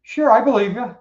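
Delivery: high, loud, breezy agreement; easy acceptance. Retold or imitated. Abraham cheerfully accepting God's promise, high and breezy. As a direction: play Abraham shrugging yes to God. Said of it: "this guy goes".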